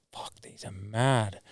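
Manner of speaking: slow hushed whisper. Retold or imitated. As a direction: breathe it out, slow and unvoiced. Adam trying on the shirt.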